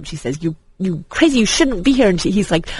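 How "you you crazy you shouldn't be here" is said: mild scolding. Retold or imitated. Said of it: "she scolds him".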